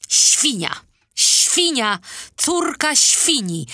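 loud, high-pitched cry of abuse; angry shouted insult. A narrator's reading. Furious girl alone in the garden cursing her absent nanny, shouting. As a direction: shout it out high and hard, hurling each word. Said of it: "wykrzyczała".

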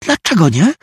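high hissed demand; whisper yelling. A narrator's reading. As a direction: hiss it, furious but hushed. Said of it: "syknęła". (syknięcie szeptem)

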